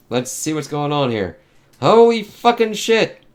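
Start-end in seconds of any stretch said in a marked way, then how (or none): 1.8-3.2 high, loud shocked exclamation; real disbelief bursting out, drawn out slowly; stunned outburst. Real, not acted. Viewer reacting to the monster, loud stunned disbelief.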